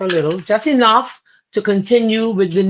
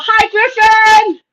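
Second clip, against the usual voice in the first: delighted greeting; 12 semitones higher, 6 dB louder, much slower than usual. Real, not acted. Greeting friend in chat.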